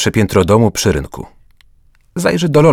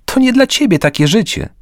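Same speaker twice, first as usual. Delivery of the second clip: insistent; 14 semitones higher than usual. A narrator's reading. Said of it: "wykrzyknął".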